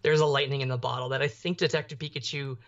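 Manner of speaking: ordinary delivery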